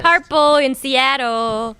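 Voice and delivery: playful character voice; cartoon voice. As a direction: stretch Seattle in a squeaky cartoon voice. Guest sings out her color.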